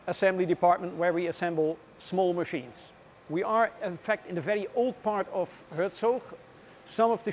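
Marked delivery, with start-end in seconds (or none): none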